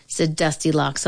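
usual speaking voice

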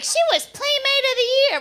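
very high, put-on protesting voice; mock defender. People defending Anna Nicole's looks, high mock protest.

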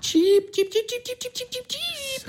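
very high, fading chirps like a little bird; bird chirping. Acted. Teasing the others with a high, fading birdlike cheep.

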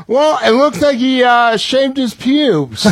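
dad deadpan but cracking up; dad's verdict, laughing. Retold or imitated. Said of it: "and he goes".

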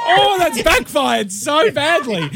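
high, drawn-out wail of dismay through laughter; laughing dismay. Real, not acted. Host's question to the caller backfires, laughing dismay.

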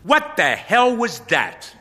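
loud, high indignant demand. Acted. Pastor confronting Toby, loud and indignant. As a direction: call him out hard. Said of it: "face is filled with indignation".

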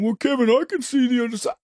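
quick, lightly mocking voice; lightly mock the persuader's voice. Mocking people who try to guilt him, quick and light. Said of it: "guilt you into going".

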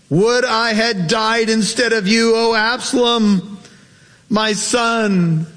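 high, wailing father's cry of grief; David's weeping lament. Acted. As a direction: cry it out like a father who lost his son. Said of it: "and wept".

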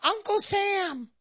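high squeaky little man voice; mock little Uncle Sam squeak; tiny little voice. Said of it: "And he says".

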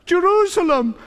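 high-pitched, emphatic doubled cry; retold cry. Preacher voicing Jesus crying over the city, emphatic high cry. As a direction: cry the name out twice, pitched well up. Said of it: "When Jesus cried".

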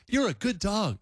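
put-on first try at a high baby-talk voice; baby talk to a dog. Shown as a demonstration. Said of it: "I can't get high enough".

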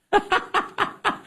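high burst of real laughter; real high-pitched laughing. Real, not acted. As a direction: laugh out in disbelief. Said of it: "There's no way".

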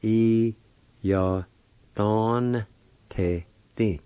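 "I Ya Don Te Di" in separate, held syllables; syllable-by-syllable pronunciation. Shown as a demonstration. Teacher showing learners the second part, "I Ya Don Te Di" syllable by syllable. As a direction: sound out "I Ya Don Te Di" with gaps between. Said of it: "pronounce the N sound with the D A like this".

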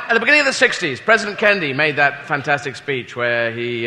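usual speaking voice

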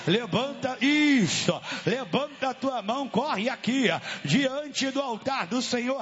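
forceful revival preaching; fiery exorcism sermon. Real, not acted.

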